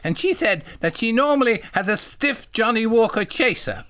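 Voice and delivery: high, simple-minded little voice; eager, high-pitched polite question; childlike John. Acted. Voicing John in the story.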